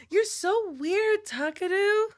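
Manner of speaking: normal talking voice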